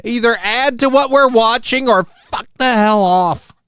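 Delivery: high, punchy irritated demand; angry rant. Real, not acted. Angry rant at a reaction video.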